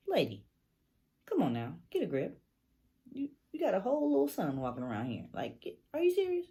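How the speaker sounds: rising to high, loud, quick disbelief; exasperated, pitch climbing as it goes; retold exasperation. Retold exasperated reply to mom.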